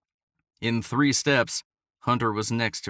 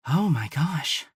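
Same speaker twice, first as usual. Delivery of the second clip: awed; 5 semitones higher, slower than usual. Acted. Awed by the gallery.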